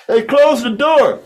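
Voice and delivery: loud call across the room. Real, not acted. Calling out to shut door.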